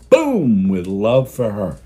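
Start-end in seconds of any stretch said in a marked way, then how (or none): 0.1-0.7 a sudden higher, louder boom. Real, not acted. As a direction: burst out the word like an explosion. Heart explodes.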